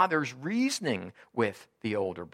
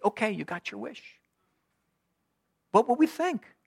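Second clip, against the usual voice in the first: incredulous exclamation; 11 semitones higher, 4 dB louder than usual. Acted. Imagined God, then exclaimed.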